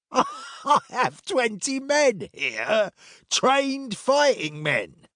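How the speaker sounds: high, drawn-out boast; scoffing centurion. A narrator's reading. Centurion scoffing at two travellers' threat, high drawn-out boast. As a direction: scoff high and slow, incredulous.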